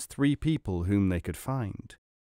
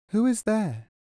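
much higher inner voice asking, falling at the end; a raised voice behind the door asks again; 10 semitones higher than usual. A narrator's reading. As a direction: ask from within, lifted and gentle.

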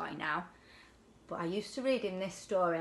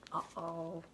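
soft uh-oh; 3 semitones lower, 10 dB quieter than usual. A narrator's reading. Tiddler trapped inside fish.

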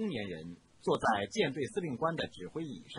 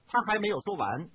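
ordinary delivery

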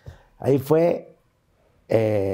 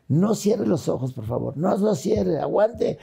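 slow, raised-pitch retold plea; doctor urging desperately; 4 semitones higher, slower than usual. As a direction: urge a patient to hold on, higher. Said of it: "ya en desesperación".

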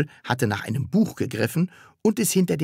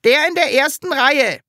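high, loud schoolboy report; eager model pupil; 6 dB louder than usual. Acted. Top pupil reporting to his teacher, eager and high.

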